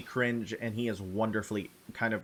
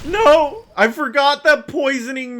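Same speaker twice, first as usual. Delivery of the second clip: dismayed shout; 14 semitones higher, 11 dB louder than usual. Real, not acted. Realizes poisoning halves healing.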